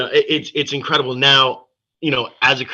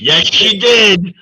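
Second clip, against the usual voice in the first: excited; 10 semitones higher, 8 dB louder, slower than usual. Real, not acted. Confirming with delight.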